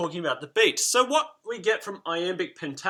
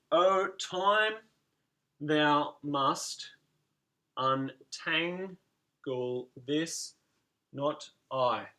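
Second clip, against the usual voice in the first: spaced out and counted; syllable by syllable; much slower than usual. Shown as a demonstration. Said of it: "we can put the dots and dashes in".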